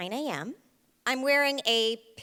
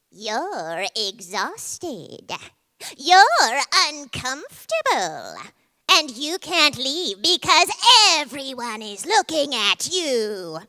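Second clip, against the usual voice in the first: slow, nagging inner voice that grows louder; anxious thoughts; 7 dB louder, much slower than usual. Acted. Her own thoughts pestering her at the finish line, slow and looming. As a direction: let the thoughts drag and loom. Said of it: "my fucking thoughts show up. They go".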